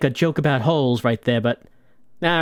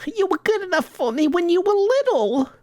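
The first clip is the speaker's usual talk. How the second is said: put-on much higher old mother voice; old Italian mama; 8 semitones higher than usual. Mama scolding her gangster son, high and tearful. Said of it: "we're talking to Mama".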